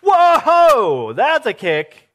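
high loud whoa, then a wry comment; surprised by the baby kicking; startled pregnant woman. Retold or imitated. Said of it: "She was probably like".

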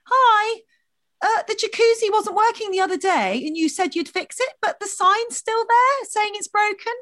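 high, sugary, pointed politeness; fake-nice complaint at reception; sweetly passive aggressive. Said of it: "tried this line instead".

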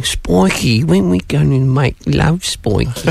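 put-on high, dreamy girlish voice; Spike's girlfriend voice. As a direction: coo it like a lovesick vampire girlfriend. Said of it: "Spike's girlfriend saying".

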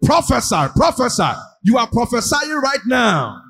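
high, loud urging call; booming motivational speech. Acted. Preacher urges congregation.

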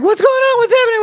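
put-on very high frightened kid voice; mock panicked child; scared kid. Small kids confused during riot, very high. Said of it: "we're like".

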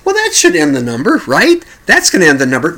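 hopeful thought. Retold or imitated. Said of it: "And I'm thinking".

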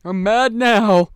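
loud, high, drawn-out whine; hurt big oaf. Acted. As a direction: bellow slowly like a wounded doofus. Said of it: "he's like".

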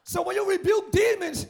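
ordinary talk

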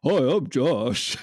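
slow, drawn-out mock Josh greeting, laughing; imitating Josh. [name] shows the group his slow Josh impression. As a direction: stretch it out with a laugh. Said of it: "It clearly sounds more like this".

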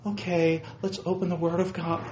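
mild, soft sing-song teacher voice; a hint of a preschool teacher; kindergarten teacher. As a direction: talk down like to small children, lightly. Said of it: "they sound like they're a kindergarten teacher".

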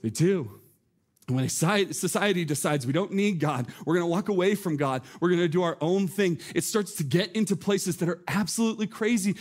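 ordinary delivery

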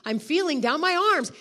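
imitated startled outburst; retold yell. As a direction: yell it out like a man astonished. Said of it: "he yelled out".

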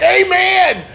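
high, drawn-out imitation pastor amen; exaggerated high-pitched amen; pastor's amen. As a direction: mimic pastors crying out in agreement. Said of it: "Every pastor on the face of the planet".

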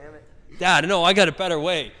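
loud, high protest to dad; exasperated kid. Retold or imitated. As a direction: shout over your dad like a know-it-all son. Retold argument with his dad as a kid.

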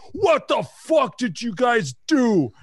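high, slow furious shout; imitating a brother's angry yell; angry older brother. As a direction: yell at the kids who caused the mess. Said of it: "all of a sudden".

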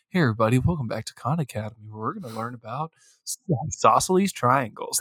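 low, fast, chirpy tutorial host; online tutor voice. Retold or imitated. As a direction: greet viewers like a cheery YouTube math teacher. Aliens play a Khan Academy video.

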